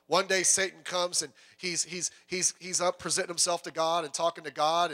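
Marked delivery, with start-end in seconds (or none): none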